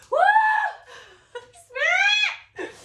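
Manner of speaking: high squealed cheer; giddy, screechy outburst; excited whoop. Retold or imitated. Acts out her excited reaction.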